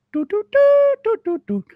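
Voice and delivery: high, tidy sung elk-call notes; sung bugle notes. Retold or imitated. Mocking a fake elk bugle, high sung toots. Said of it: "real three, four tone".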